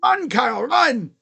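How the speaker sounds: loud, high call to a runner; excited urging; urging shout. Real, not acted. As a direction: yell him on toward the base. Calling to the runner.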